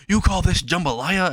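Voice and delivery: lightly acted angry customer; mock outrage. Acted complaint to cafeteria staff.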